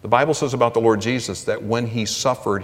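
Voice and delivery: ordinary talk, nothing marked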